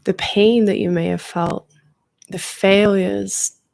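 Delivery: calm guide easing listeners into relaxation; guided meditation. Acted.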